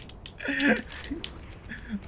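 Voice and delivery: high falling laughter. Real, not acted. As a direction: let the laugh fall away.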